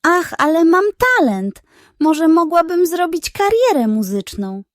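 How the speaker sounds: high, dreamy hen voice, pleased with herself; naive dreamy hen. Acted. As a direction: gush over your own talent. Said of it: "rozmarzyła się naiwnie".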